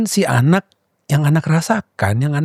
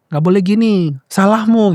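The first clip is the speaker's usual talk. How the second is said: louder, higher blaming parent; judging parent; 5 semitones higher, 3 dB louder than usual. Retold or imitated. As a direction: snap a judgement at the child. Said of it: "langsung menjudge". (orang tua yang langsung menghakimi anak, lebih keras dan tinggi)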